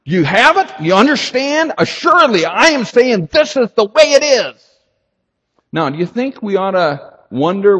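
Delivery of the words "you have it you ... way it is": louder and higher, full conviction; emphatic declaration. Retold or imitated. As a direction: pound home the authority. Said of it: "He is saying".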